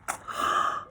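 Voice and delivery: a sharp breathy intake of air; shocked gasp. Acted. Ellie seeing the ruined top, a shocked gasp. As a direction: gasp as if caught out.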